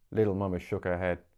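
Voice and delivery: ordinary delivery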